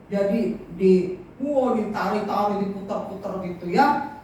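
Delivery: ordinary delivery, nothing marked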